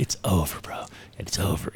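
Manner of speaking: whispering the verdict to the crying friend; whispered it's over. Retold or imitated. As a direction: drop to a whisper, letting it sink in. Repeating it's over, softer.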